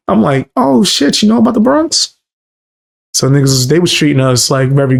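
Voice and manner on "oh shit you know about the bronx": surprised high voice. Retold or imitated. Surprised the locals know the Bronx.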